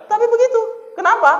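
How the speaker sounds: preacher asks the crowd, loud and high; rhetorical preacher's cry. Acted. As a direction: jolt the audience awake.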